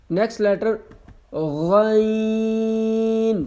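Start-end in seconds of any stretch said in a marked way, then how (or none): 1.3-3.4 غین stretched from the throat like gargling; throaty gargled غین; gargled Ghain. Shown as a demonstration. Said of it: "گلے کے شروع سے پڑھا جاتا ہے".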